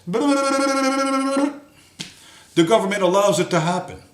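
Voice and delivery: high silly mock voice; mocking parroted excuse. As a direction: parody a lame excuse. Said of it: "end up saying something like this".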